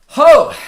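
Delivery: a sudden very high, loud cry of emotion; emotional cry. Real, not acted. Streamer moved after reading a farewell letter, loud cry. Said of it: "That was a little emotional".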